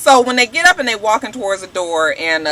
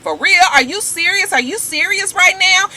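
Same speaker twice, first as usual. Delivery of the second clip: higher and higher, disbelieving; incredulous; 10 semitones higher than usual. Retold or imitated. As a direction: confront him, rising in disbelief.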